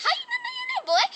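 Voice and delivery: very high, incredulous squeal; disbelieving sass. Retold or imitated. Acted quote, disbelieving.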